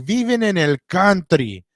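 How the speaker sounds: high-pitched imitation of an Argentinian guy from a video; Argentinian cat. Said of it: "the Argentinian cat is like".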